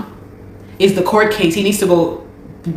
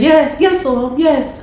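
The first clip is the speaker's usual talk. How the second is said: higher-pitched mimicked woman's yes; quoted reply; 8 semitones higher, slower than usual. Immigration lady confirming he's back, higher mimicking voice. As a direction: lightly mimic her repeated admission. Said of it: "She said".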